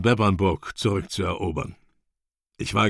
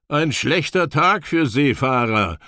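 higher-pitched call to a companion; 6 semitones higher than usual. A narrator's reading. Young warrior to the narrator on a windy shore, calling out. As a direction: call it across the wind. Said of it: "rief mir Berg zu".

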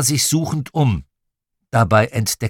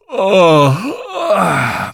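high, loud wail of a rudely woken father; drawn-out howling moan; loud pained groan; 8 semitones higher, 7 dB louder than usual. A narrator's reading. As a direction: groan out in protest. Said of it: "machte Papa".

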